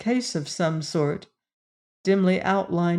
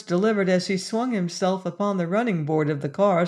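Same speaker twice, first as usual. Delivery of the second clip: plain reading voice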